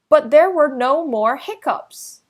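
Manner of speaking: plain reading voice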